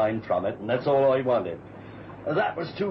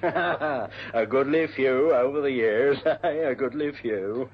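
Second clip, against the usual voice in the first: chuckling "A goodly few"; hearty laughter breaking through; laughing. Acted. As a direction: laugh along good-naturedly. Laughs off the joke.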